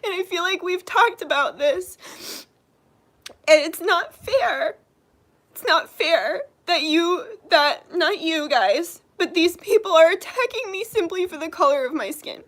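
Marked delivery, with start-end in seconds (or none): 3.5-9.0 slow, tearful words through real crying. Real, not acted. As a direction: let the tears break through slowly. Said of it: "That's why I'm crying right now".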